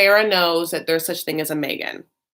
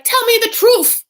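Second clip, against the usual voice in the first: high, loud mock shriek; hammed-up, shrieked demand; very upset; 8 dB louder than usual. Wife confronting Michael on the phone, hammed-up upset shriek. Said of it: "zero to sixty in the way she talks".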